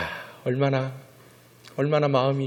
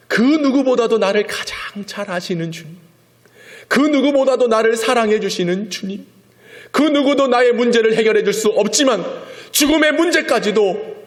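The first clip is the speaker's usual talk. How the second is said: much higher, louder, building praise; fervent preaching; 7 semitones higher, 4 dB louder than usual. Real, not acted. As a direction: preach it with rising fire. Preaching at full force.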